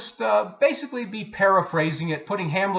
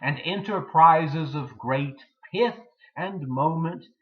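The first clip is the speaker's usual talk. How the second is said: dramatic soliloquy recitation; 2 semitones lower, slower than usual. Acted. Hamlet alone, pondering whether to be or not to be, dramatic soliloquy. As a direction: recite it as Hamlet weighing life and death.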